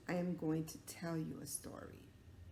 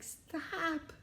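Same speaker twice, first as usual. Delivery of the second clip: high, whiny scolding; annoyed townswomen; 5 dB louder, slower than usual. Acted. Townswomen scolding the boy for his noise. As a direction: shriek at the noisy boy. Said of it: "the women in town were like".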